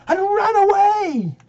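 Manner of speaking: sudden high, loud cry; mock-dramatic outburst. Reciter tells of Jim fleeing nurse, high dramatic cry. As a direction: leap up in pitch with alarm.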